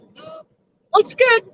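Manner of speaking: high, cheery "looks good"; imitating Clooney. Echoing the show's weather gag, chirped high. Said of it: "George Clooney did that".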